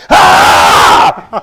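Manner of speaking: sudden loud low shout; imitating a guard jumping out with a yell; mock ambush yell. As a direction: jump out and yell. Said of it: "jumped out of his cupboard".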